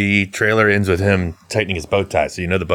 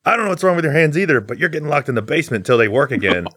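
higher, fast scolding dad; hammed-up dad sentencing son; mock angry dad; 6 semitones higher, faster than usual.

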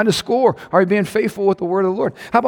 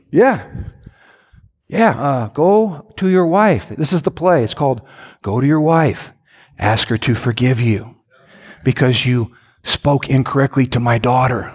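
voice of God; 7 semitones lower than usual. Retold or imitated. God coaching husband to apologize, lower calm voice. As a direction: lay out the play, firm and fatherly. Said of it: "when God says".

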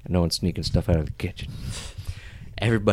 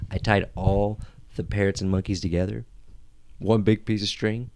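ordinary delivery

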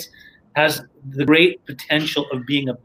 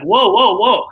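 startled whoa; 6 semitones higher, 5 dB louder, slower than usual. Retold or imitated. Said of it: "I'm like".

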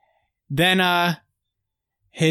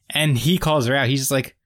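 ordinary delivery

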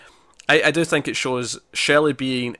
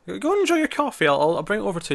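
higher-pitched waitress voice; 9 semitones higher, faster than usual. Retold or imitated. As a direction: imitate a helpful server, pitched up. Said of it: "the girl who works there is like".

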